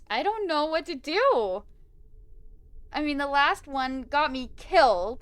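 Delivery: loud, high, nervous whine; panicky dithering. Real, not acted. Player afraid of getting killed again, loud high panicky dithering. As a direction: fret loudly over the choice. Torn over a choice in scary game.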